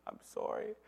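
high, breathy mock-crying apology; tearful child. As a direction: imitate a small boy apologizing through tears. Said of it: "he started crying".

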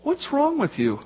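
hammed-up puzzled angel in a high voice; incredulous angel. Angel asks Mary at the tomb, comically incredulous. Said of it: "the angel says to her".

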